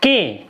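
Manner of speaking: के said as a short "ki"; short इ sound. Shown as a demonstration. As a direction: model के as k plus a brief i. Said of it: "छोटी ई को दोनों sound मिला के". (छोटी इ के साथ कि)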